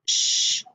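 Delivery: just the sh sound. Shown as a demonstration. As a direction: say "sh" as a voiceless shhh. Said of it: "say the sound it makes".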